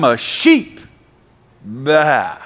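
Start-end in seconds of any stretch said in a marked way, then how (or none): 1.6-2.4 playful imitation of a sheep's baa; comic mock bleat. Imitates a sheep after saying so.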